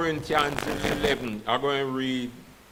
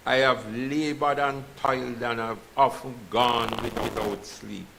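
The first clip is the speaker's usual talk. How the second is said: normal talking voice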